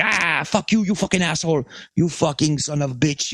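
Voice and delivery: high-pitched imitation of an angry cusser; mock screaming. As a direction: hurl curses in a mock scream, without full volume. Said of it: "just starts screaming at me".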